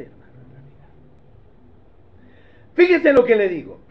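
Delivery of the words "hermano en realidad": hushed, confiding aside; a confidential whisper to the brethren. Real, not acted. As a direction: lean in and whisper it. Aside to congregation.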